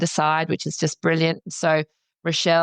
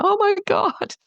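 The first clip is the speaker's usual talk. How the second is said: relieved exclamation; slower than usual. Retold or imitated. Relief talking to peers.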